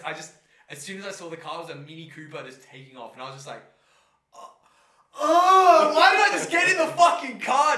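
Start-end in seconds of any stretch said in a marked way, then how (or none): 4.3-7.8 very high loud laughing yell of regret; anguished shout. Retold or imitated. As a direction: scream at yourself in disbelief. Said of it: "laugh shout".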